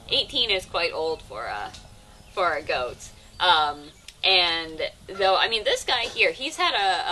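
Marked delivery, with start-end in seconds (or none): none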